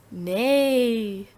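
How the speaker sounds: long neigh rising and falling; horse neigh. Shown as a demonstration. Teacher demonstrating animal sounds, drawn-out horse neigh. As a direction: stretch the word out like a horse. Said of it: "Animal sounds".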